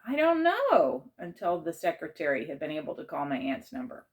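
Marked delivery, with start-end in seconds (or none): 0.1-1.1 high, slow helpless repetition; weary repeating. A narrator's reading. Narrator repeating to the principal, helpless. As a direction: drag it out, helpless.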